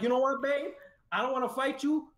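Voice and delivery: high, resigned go-ahead; giving the go-ahead. Retold or imitated. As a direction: give up and let her go. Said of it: "And I'm like".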